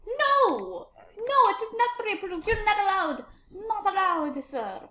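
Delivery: high-pitched, indignant officer refusal; mock-outraged refusal. Officer rejects traveller's papers, mock outrage.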